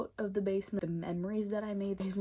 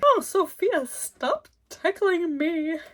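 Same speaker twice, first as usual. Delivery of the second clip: high, giggly squeal while being tickled; 11 semitones higher, 8 dB louder, slower than usual. Retold or imitated.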